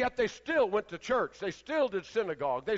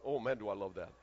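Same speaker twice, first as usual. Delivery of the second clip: low aside; 11 semitones lower, 8 dB quieter than usual. Retold or imitated. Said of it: "I went".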